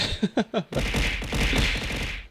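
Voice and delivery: deep drawn-out laugh; low laugh. Real, not acted. Streamer laughing at a chat message, low laugh.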